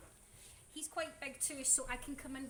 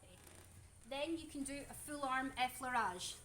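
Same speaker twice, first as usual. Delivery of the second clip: ordinary delivery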